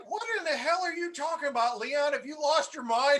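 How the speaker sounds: mock outrage at a prank call; incredulous outburst. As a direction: blurt it out like he's lost it. Retold disbelieving outburst at friend.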